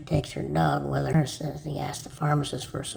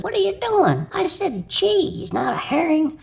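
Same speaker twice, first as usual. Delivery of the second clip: much higher, incredulous reproach; exasperated scolding; 11 semitones higher than usual. Acted. Doctor scolds the husband holding a herring, exasperated. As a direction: scold in comic disbelief.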